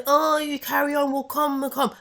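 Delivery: a hint of a gushing crowd member; mocking the fans. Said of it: "and say".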